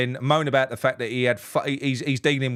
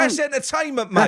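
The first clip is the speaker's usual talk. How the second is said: excited; 11 semitones higher than usual. Real, not acted. Excited reply to the quote.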